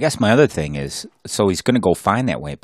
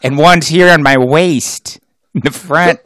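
condescending lecture; 6 semitones higher, 6 dB louder than usual. Acted. Husband lecturing wife, acted.

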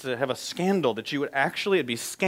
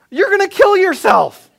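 high, loud, slow warning; a father panicking at the jump; alarmed dad; 13 dB louder, slower than usual. Retold or imitated.

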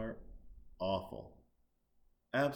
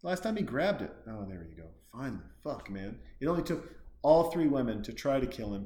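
normal talking voice

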